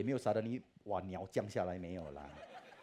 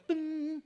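imitated phone chime, very high; ping like a phone alert; message ding; 7 dB louder than usual. Message pops up while he cries, high ding noise.

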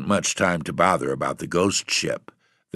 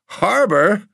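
much higher, louder astonished cry; cried out; 6 dB louder than usual. A narrator's reading. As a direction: blurt it out in disbelief. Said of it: "cried landlord".

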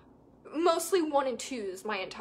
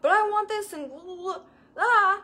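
mock whine; 5 semitones higher, 3 dB louder than usual. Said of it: "we're like".

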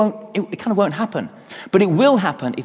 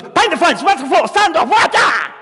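high, loud hammed-up battle cry; mock karate shout; 12 semitones higher, 6 dB louder than usual. Student blocking the master's strike, mock karate yell. Daniel instinctively fights back.

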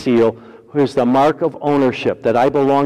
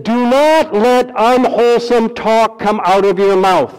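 slow, high, loud scripture read; fervent pulpit reading; preaching scripture; 8 semitones higher, 4 dB louder, slower than usual. Acted. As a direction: proclaim the verse slowly.

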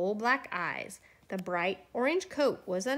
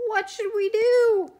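put-on high, loud cry of the children; 11 semitones higher, 8 dB louder than usual. A narrator's reading. Children to each other over a melted snowman, crying out. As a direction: call it out high and loud in chorus-like alarm. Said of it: "The children cried out".

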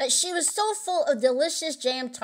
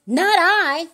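high, loud protest of innocence; frightened denial; 6 semitones higher, 5 dB louder than usual. A narrator's reading. Little Jack Horner denying the theft, frightened and high.

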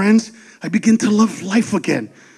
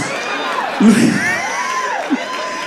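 high laugh; 4 dB quieter than usual. Real, not acted. Laughing at own joke.

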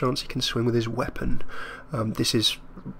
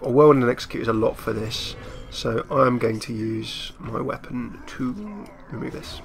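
ordinary delivery

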